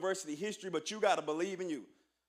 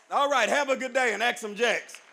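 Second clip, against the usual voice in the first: louder rally farewell; loud sign-off; 2 semitones higher, 8 dB louder than usual. Real, not acted. Speaker to the freshmen, loud closing sign-off. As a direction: send them off with a loud cheer.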